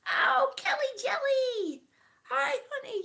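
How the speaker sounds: high, loud sing-song hello; excited greeting. Real, not acted. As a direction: greet a fan with a delighted cry. Greeting live chat viewer.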